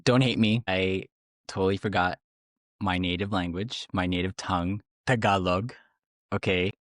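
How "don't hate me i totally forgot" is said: ordinary talk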